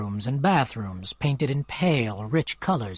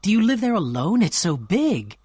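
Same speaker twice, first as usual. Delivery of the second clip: excited; 6 semitones higher than usual. A narrator's reading. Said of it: "she cried excitedly".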